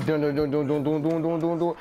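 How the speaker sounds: low, chanted dun dun drum roll; vocal drum roll. Shown as a demonstration. Vlogger announcing the top play, mouth drum roll. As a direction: mimic a drumroll with your voice. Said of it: "drum roll, please".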